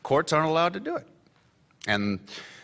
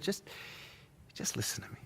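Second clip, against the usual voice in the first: hushed plea; 4 semitones higher, 17 dB quieter, faster than usual. Acted. Drunk guest pulls the editor close, whispered plea. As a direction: drop to a whisper.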